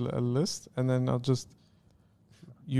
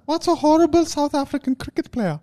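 high mock South African voice; badly faked South African accent; 11 semitones higher than usual. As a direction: ask it in exaggerated South African. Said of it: "Remove this accent from the list".

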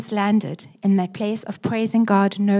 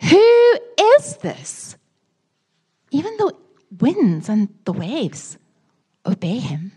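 awed fear; 4 semitones higher, 4 dB louder than usual. Acted. Terrified disciples ask each other.

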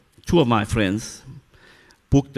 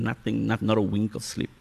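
normal talking voice

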